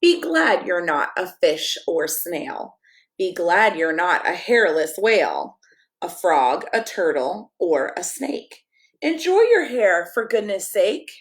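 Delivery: lively picture-book read-aloud, sing-song rhymes; reading to children. Acted. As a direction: read it like a bedtime story for kids.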